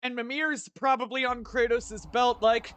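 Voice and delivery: ordinary talk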